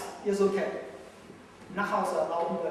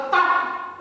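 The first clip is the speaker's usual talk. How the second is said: a quick unvoiced "papp" for the slap, pitched up; mock slap noise; 10 semitones higher, 5 dB louder than usual. Teacher miming a slap on the student, mock slap noise. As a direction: smack the air with a sharp voiceless papp.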